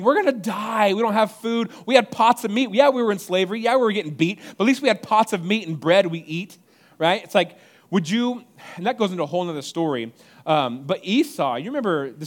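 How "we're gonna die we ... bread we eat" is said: higher and louder, whiny grumbling; mock whining Israelites.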